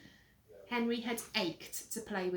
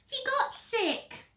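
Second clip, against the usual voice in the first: put-on high, loud child voice; whiny little brother; 6 dB louder, slower than usual. Peter catching Henry in his room, high whine. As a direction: pipe up in a high brat voice.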